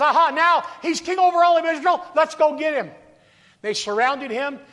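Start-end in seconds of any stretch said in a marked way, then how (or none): 0.0-2.9 loud, fast gloating with a laugh in it; gloating Philistines. Acted. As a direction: crow over the new king like you've caught him. Philistines plotting against newly crowned David.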